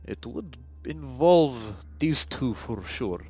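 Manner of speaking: low, quiet doctor voice, halting between phrases; weary underground doctor. Acted. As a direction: speak low and deliberately, resigned.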